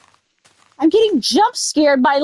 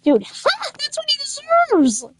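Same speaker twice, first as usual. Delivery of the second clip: much higher, gleeful gloat; high-pitched gleeful taunt; gloating squeal; 12 semitones higher, 3 dB quieter than usual. Real, not acted. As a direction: crow over the kill in a high voice. Gloating over killed zombie.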